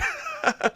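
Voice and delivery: short, high-pitched scoffing laugh; quick high derisive giggle; mocking laugh. Real, not acted. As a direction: laugh off their boast. Scoffs at rival fans' boast.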